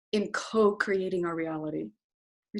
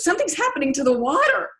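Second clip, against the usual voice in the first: higher, louder and quick alarm from the instructor; surprised alarm; 10 semitones higher, 9 dB louder, faster than usual. Retold or imitated. The instructor watching the meter, alarmed and surprised. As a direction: blurt out that something is wrong. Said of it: "and he goes".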